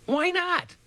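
high, thin frightened voice; squeaky scared reply. Acted. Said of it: "He sounded so frightened".

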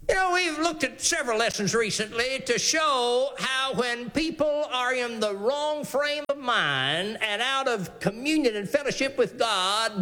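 ordinary talk

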